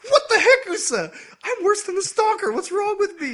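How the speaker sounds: very high, loud freak-out; shrill-pitched comic panic at your own behavior; hammed-up self-reproach. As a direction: yell at yourself in mock horror. Acting character's panicked self-reproach.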